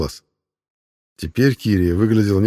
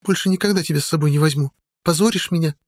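scolding woman; 10 semitones higher than usual. A narrator's reading. Sodia scolding.

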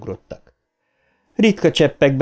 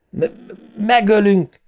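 high-pitched stuttered shout; stammered cry; 10 semitones higher than usual. A narrator's reading. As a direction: stumble on the first sound, then cry it out. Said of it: "kiáltotta Bill".

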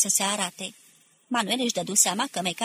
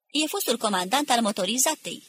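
ordinary delivery

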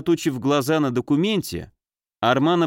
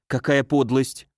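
usual speaking voice

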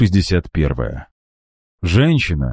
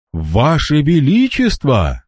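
high, slow startled cry; surprised exclamation; 8 semitones higher, slower than usual. A narrator's reading. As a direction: gasp out the greeting. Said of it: "в один голос воскликнули женщины".

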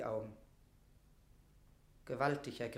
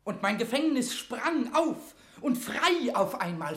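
fervent reply; 7 semitones higher, 8 dB louder than usual. Acted. Mortimer answers with fervour.

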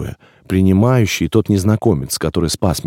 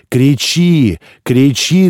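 loud, higher order; commanding shout; 5 semitones higher, 4 dB louder, much slower than usual. A narrator's reading. Receiver orders the newborn to cry, loud command. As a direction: bark the order to scream. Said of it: "приказ принимающего".

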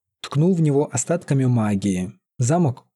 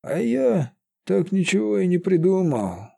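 high sad wizard voice; 7 semitones higher than usual. A narrator's reading. Sad wizard laments his failure.

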